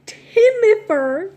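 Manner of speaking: Timifer called out loud and slow; emphasized name. Real, not acted. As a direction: make them remember Timifer. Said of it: "remember that name".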